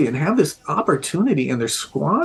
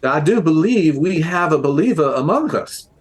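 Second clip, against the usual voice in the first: higher, lilting mock New Orleans accent; 4 semitones higher than usual. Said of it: "doing this, like, New Orleans accent".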